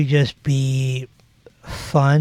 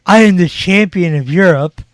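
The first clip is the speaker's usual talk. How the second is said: loud, higher-pitched champion's boast; 5 semitones higher, 8 dB louder than usual. Retold or imitated. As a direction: proclaim it loudly like a wrestling champion.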